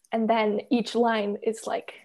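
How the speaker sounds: ordinary talk, nothing marked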